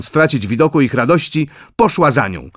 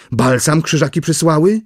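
eager exclamation; 5 semitones higher than usual. A narrator's reading. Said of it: "zawołała żywo Danusia".

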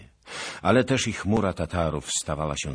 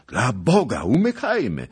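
high, loud panicked urging; alarmed cry; 8 semitones higher, 9 dB louder than usual. A narrator's reading. Squire to companions fleeing Tatars, high alarmed shout. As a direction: cry out to flee at once.

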